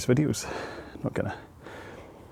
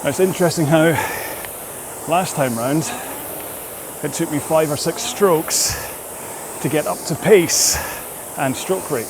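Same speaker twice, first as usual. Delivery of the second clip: higher voice, phrases broken by effort; talking while rowing; 5 semitones higher than usual. Real, not acted. Rower explains the interval mid-effort, talking through exertion. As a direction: push short phrases out between rowing strokes.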